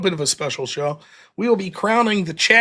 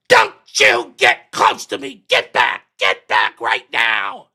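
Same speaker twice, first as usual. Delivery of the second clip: loud, slow mock yell of a panicked woman; loud, drawn-out imitation of a scared woman yelling get back; retold screaming woman; 6 semitones lower, 5 dB louder, slower than usual. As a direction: bark it out slowly, shooing him off. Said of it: "And starts screaming".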